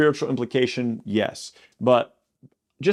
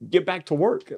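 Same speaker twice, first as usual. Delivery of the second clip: higher-pitched, mock-impatient command; exasperated order; 7 semitones higher than usual. God sending Elijah back to his task, mildly exasperated. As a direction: send him off with exasperated insistence.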